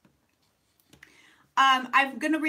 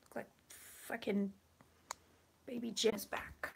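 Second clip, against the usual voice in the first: whispering; 4 semitones lower, 13 dB quieter than usual. Real, not acted. Setting up camera quietly.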